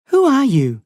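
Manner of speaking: alarmed high cry; frightened boy. A narrator's reading.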